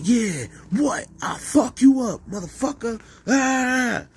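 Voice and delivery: a hammed-up brawler yelling threats; mock fight yell. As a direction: scream it like you are squaring up.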